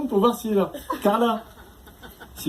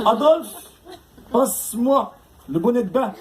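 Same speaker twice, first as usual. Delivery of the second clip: German torturer; 4 semitones higher, slower than usual. Acted. Torturer to henchman Adolphe, high commanding mock-villain voice. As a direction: order the henchman like a hammy German interrogator.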